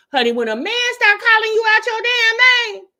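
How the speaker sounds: sassy high outburst. Real, not acted. To women watching, a loud high sassy warning. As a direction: shoot it up high and loud with full attitude.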